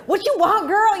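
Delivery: high, loud mom voice; sassy mom. Retold or imitated. Mother answering her daughter's call, high and sassy. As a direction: play the mother cutting in.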